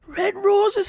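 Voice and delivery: very high incredulous squawk; imitating an appalled husband, pitched right up; Big Davey. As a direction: repeat it as if outraged by the idea. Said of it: "He went".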